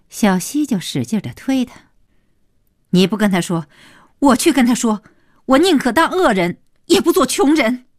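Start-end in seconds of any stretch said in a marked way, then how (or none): 2.9-7.8 raised, high, agitated voice; worked-up wife pushing back; defiant outburst. A narrator's reading. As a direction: burst out in a high, loud protest. Wife shoving husband, defiant outburst.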